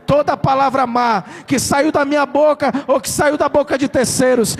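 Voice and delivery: loud, high declaration shouted to the crowd; shouted prayer of declaration; fiery preaching. Acted. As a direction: proclaim it at full force like a revival preacher.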